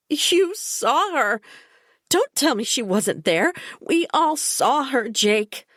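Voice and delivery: cling to what you saw, near hysteria; hysterical insistence. A narrator's reading. The narrator insisting to Jake that they all saw her, hysterical.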